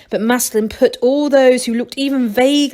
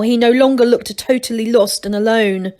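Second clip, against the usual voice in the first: plain reading voice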